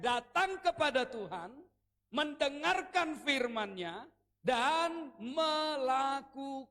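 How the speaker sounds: slowed, each phrase drawn out; slow deliberate preacher cadence; slow emphatic teaching. Shown as a demonstration. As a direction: spell it out word by word for the congregation. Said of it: "perhatikan baik".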